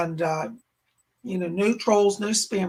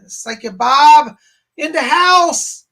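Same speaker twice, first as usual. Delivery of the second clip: host announcement; 5 semitones higher, 12 dB louder, slower than usual. Acted. Host announces himself on stream.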